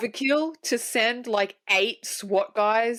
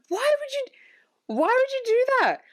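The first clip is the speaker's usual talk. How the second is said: exasperated disbelief. Retold or imitated. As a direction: stretch it out high like a baffled cartoon voice. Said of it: "And I'm like".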